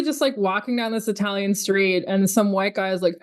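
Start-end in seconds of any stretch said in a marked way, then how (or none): none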